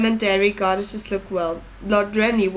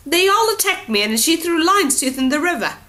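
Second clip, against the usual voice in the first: high, louder whine; whining accusation; 8 semitones higher, 5 dB louder than usual. A narrator's reading. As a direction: complain shrilly.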